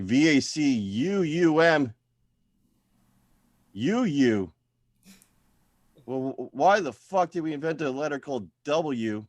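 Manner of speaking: slow, spelled-out mock outrage; hammed-up fury over a word's spelling; mock rage. Comic ranting at the word vacuum, mock-furious spelling.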